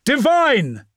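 high, loud rallying yell. A narrator's reading. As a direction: shout it as a battle cry. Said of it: "and yelled".

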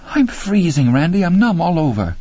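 high, whining female voice; acted miserable, high-pitched complaint; plaintive freezing woman. A narrator's reading. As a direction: moan about the cold.

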